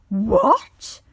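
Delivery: wizard shouting, outraged and loud; angry shout. A narrator's reading. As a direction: roar at him for opening the boxes. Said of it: "shouted the other angrily".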